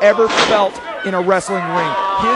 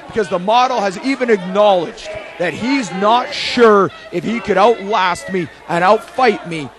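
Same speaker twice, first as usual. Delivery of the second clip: ordinary delivery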